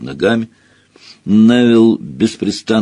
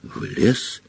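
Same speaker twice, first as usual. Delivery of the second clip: quiet, nervous question; unsure, shaken; 7 dB quieter than usual. A narrator's reading. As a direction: lose the swagger. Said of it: "голос у него был совсем не такой самоуверенный".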